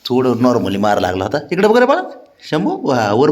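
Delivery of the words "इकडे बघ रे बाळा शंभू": much higher, coaxing sing-song. Real, not acted. Owner coaxing his pet to look up, high baby-talk coo.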